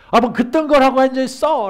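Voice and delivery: high, loud irritated snap at the partner; a spouse snapping back in irritation. Retold or imitated. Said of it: "라고 한번 쏘아붙이면".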